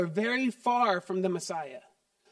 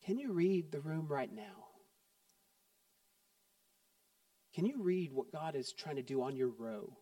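quiet altar call; 5 semitones lower, 9 dB quieter than usual. Acted. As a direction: gently invite the congregation inward.

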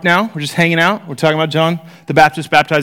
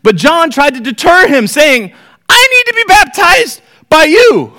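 high, louder reading that peaks on John's protest; scripture reading; 10 semitones higher, 5 dB louder than usual. Acted. Teacher reading John's protest to the class, high and loud. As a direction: rise up on the protest.

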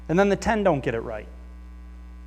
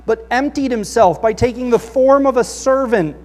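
loud, raised scripture reading; 7 semitones higher, 8 dB louder than usual. Acted. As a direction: proclaim the passage to the congregation. Reads scripture aloud.

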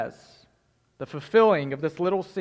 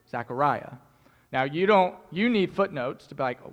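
usual speaking voice

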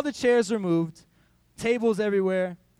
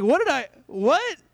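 stunned disbelief; 9 semitones higher, 8 dB louder than usual. Retold or imitated. Hearing he was kicked off.

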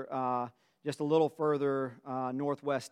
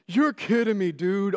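high, loud burst of disbelief; excited disbelief; 6 semitones higher, 8 dB louder than usual. Retold or imitated. On the phone with his friend, shouting in disbelief. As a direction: blurt it out, stunned and thrilled. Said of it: "I'm crying".

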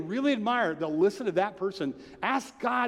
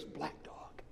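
very soft, near-whispered quote; hushed retold answer; 18 dB quieter than usual. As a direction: imitate a friend's hushed, cryptic reply. Retold friend's cryptic answer on phone.

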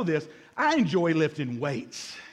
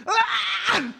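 straining lift yell; 12 semitones higher than usual. Retold or imitated. Husband straining at the gym to impress his wife, yelling. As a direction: yell as you heave the weight.

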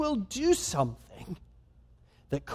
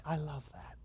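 soft fond approval; 4 semitones lower, 15 dB quieter than usual. Retold or imitated. God fondly approving, softly.

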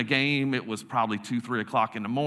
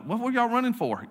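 higher-pitched puzzled buddy; bewildered protest; 7 semitones higher than usual. Retold or imitated. Unmasked buddy asks the boys who chased him, bewildered. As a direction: imitate a chased friend asking why.

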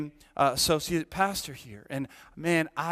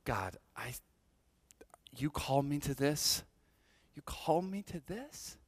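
soft, hurt questioning of God; hushed complaint to God; quiet lament; 8 dB quieter than usual. Retold or imitated. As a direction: murmur it like a weary prayer. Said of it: "how often did I say".

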